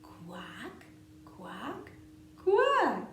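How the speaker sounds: duck quacking. Pretend. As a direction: quack like a playful duckling. Duck refrain.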